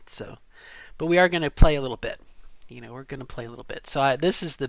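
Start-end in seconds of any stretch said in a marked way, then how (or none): 1.0-2.2 loud, fast, playful announcement; louder announcement. Real, not acted. Playful announcement, then repeated softly. 2.7-3.9 quiet, fast repeat; soft aside. Real, not acted. Same line repeated, much softer.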